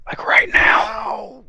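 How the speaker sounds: full whisper, slow on the last words. Acted. Officer whispering back to the guard, unvoiced whisper. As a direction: breathe it back as a secret whisper. Answering her whisper in kind.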